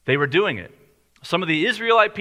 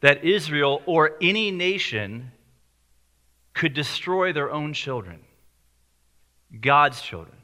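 slow, deliberate, with long pauses; slowly. Real, not acted. Preacher to congregation, slow and deliberate. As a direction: weigh each word slowly. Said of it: "I'll say this sentence slowly".

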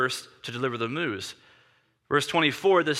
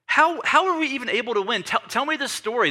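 higher, eager questioning; amazed eagerness; 7 semitones higher than usual. Acted. King asks how the battle was won, amazed and eager. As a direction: ask it in happy disbelief.

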